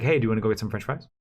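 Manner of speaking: rattled off, very fast; rushed fast talk. Retold or imitated. Husband imitating his own rushed dating talk, fast. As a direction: blurt the whole invitation in one breath. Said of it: "I speak fast".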